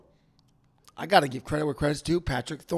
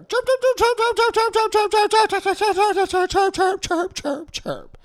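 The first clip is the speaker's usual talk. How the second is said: rapid high chirp chirp chirp, much higher and louder; mock bird chirping; 4 dB louder than usual. Mimicking Michigan fans chirping at the Bulldog fan, high rapid chirps. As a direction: peck out a string of high mocking chirps.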